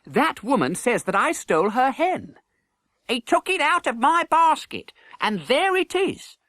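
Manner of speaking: put-on high boy's voice, protesting; young prince pleading. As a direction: plead his innocence to Miles. Edward's voice.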